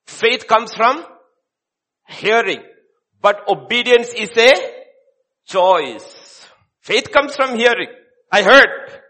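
loud, high, punched-out sermon phrases; forceful preaching. Real, not acted. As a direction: hammer it home from the pulpit.

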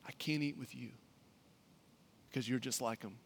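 soft unvoiced whisper. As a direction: breathe it almost silently. Whispered aside in sermon.

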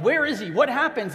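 high, loud frantic questions; urgent panicked shouting; acted panic. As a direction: call out in a panic over the storm. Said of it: "so in panic, they're like".

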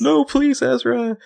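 very high begging voice; mock pleading. Arya pleading over the wounded Ezra, very high mock plea. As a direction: plead with him desperately.

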